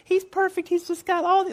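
very high put-on girl voice; squeaky-high mock girlish gushing. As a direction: mimic a smitten girl in falsetto. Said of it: "that's my girl voice".

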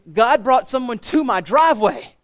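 DRIVEWAY hit high and loud; emphatic disbelief. Real, not acted. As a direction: punch "driveway" as if astonished at it. Emphatic disbelief at missed chance.

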